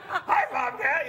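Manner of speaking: high, loud puppet hello; Grover impression. Comic comparing puppet with his act, high cartoon voice. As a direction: chirp the greeting in a puppet voice. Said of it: "it's really close".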